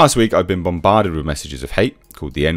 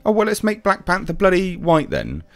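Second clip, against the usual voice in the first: high mocking sarcasm; sarcastic comeback, pitched high; 12 semitones higher than usual. Real, not acted. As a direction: throw the line back with scorn. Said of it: "sarcastic retort".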